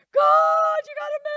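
loud, high crying out to God; shouted desperate prayer. Acted. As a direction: plead at full voice, begging God to move.

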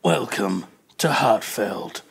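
slow, low trailer narration; trailer voiceover. Acted. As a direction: read it like a teasing game trailer, slowly. Reads out game's romance trailer.

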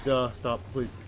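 loud frantic plea; being chased. Real, not acted. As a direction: cry out, panicked. Fleeing monster.

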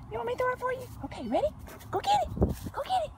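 high, eager coaxing. Real, not acted. Owner pumps up the puppy before throwing the ball. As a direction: rev her up to fetch.

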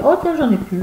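high-pitched acted discovery; mock surprised exclamation; sudden surprise. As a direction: act suddenly finding it empty. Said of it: "Tout d'un coup, on se dit".

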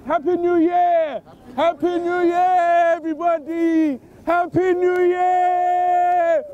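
long held New Year cheer; drawn-out street-party holler; celebratory yell. Real, not acted. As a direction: stretch every last word right out.

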